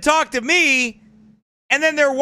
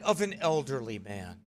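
much lower and softer, mocking; mocking echo; 11 semitones lower, 13 dB quieter than usual. Shown as a demonstration. Mocking the clip's phrasing in a low voice. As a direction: mutter it back low in mockery. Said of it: "You like how they said it?".

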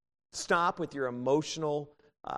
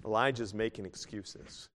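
ordinary delivery

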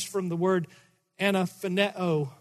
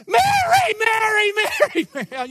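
much higher, louder shouting of a name, fading out; enacted joyful cry; 8 dB louder than usual. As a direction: cry out the name in wild joy, then let it fall. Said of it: "I really wanna try to enact this".

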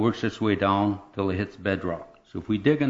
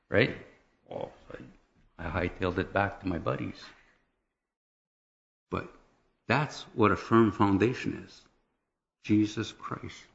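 ordinary talk

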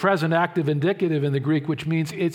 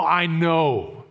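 "I know" with a different tone, stress on "know"; emphasis with tone. Shown as a demonstration. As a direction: say "I know" like bedrock certainty. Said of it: "how we emphasize it with tone".

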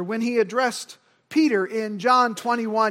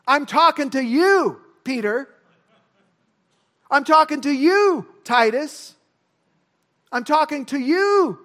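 loud, punched stress on "you"; single out the listener with a higher, louder "you"; emphatic direct address; 4 semitones higher, 5 dB louder than usual. Acted. Preacher singling out Peter, Titus and church, emphatic. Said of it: "the same kind of emphasis".

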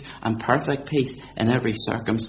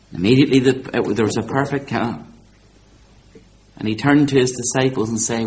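ordinary delivery

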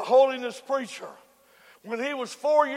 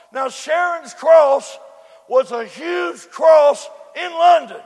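ordinary talk, nothing marked

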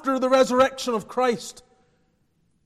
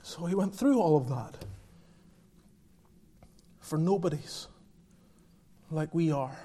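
softly and lower, "through" gently stressed; hushed, quiet; 6 semitones lower, 7 dB quieter than usual. Acted. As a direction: let it settle, quiet and low. Quiet reflective answer.

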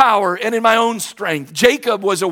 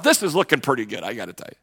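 low, soft aside to himself; inner thought; 9 semitones lower, 8 dB quieter, faster than usual. Retold or imitated. Pastor thinking to himself in court, quiet aside. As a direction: mutter the thought under the breath. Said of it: "And I'm thinking to myself".

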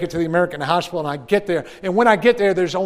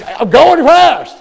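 loud reply; 9 semitones higher, 12 dB louder, much slower than usual. Retold or imitated. Doctor answers loudly.

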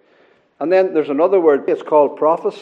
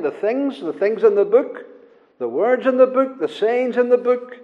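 higher pitched, each "the" leaned on; emphatic listing; 5 semitones higher than usual. Real, not acted. Preacher pointing each term back to the book, emphatic. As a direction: build the list, striking each item.

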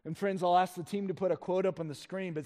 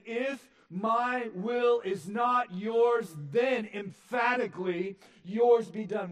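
slow, high, loud, each word weighted; emphatic rejection; 7 semitones higher, 6 dB louder, slower than usual. Acted. Jesus firmly yields to the Father, slow and loud. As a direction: press every word as a firm refusal. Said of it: "Jesus is saying".

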